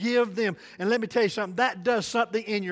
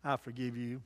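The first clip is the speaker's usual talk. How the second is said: much softer, gentle second time; soft repeat; 8 semitones lower, 13 dB quieter than usual. Shown as a demonstration. Preacher repeating forgiveness to the wronged, much softer. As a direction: let it sink to a hush. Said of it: "the best thing to give is say".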